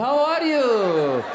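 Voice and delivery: put-on as if nodding off, in an Iranian accent; drowsy Iranian accent. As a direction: stretch each word sleepily, Iranian accent. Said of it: "we just shot some heroin, we're falling asleep".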